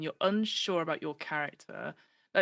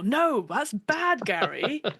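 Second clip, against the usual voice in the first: mock scolding; 5 semitones higher, 5 dB louder, much slower than usual.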